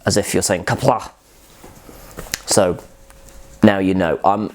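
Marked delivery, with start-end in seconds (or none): 0.6-1.1 "Kapla." with a throaty Klingon K and rasp; Klingon sound. Shown as a demonstration. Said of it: "like you're a Klingon".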